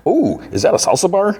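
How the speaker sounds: high-pitched delighted "oh"; excited discovery. Retold or imitated. Sweet Tooth spots the salsa bar, high and excited. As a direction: pipe up as if spotting a treat.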